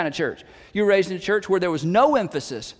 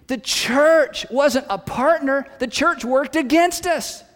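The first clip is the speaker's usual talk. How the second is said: each word slowed and weighted; slow emphatic point; 4 semitones higher, 3 dB louder, slower than usual. Real, not acted. Preacher telling the congregation the church failed them, slow and emphatic. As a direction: spell it out slowly, higher. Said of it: "slow emphatic point".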